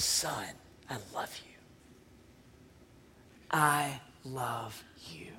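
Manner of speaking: a barely voiced loving father, slowing on the last words; tender whisper. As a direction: breathe it into his ear as you hold him. Father embraces returning son.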